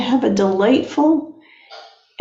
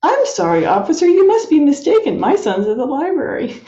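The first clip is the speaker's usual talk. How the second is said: high-pitched, mock-earnest dad talking to an officer; sitcom father on phone; 7 semitones higher than usual. Father telling an officer he must be mistaken, acted sitcom dad. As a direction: play a father politely protesting, pitched well up. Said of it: "and he says".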